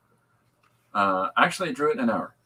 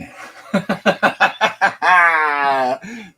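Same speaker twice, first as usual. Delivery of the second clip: real laughter; 7 semitones higher, 7 dB louder than usual. Real, not acted. Laughing at a typo.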